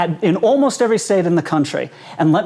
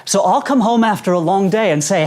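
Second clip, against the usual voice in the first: ordinary delivery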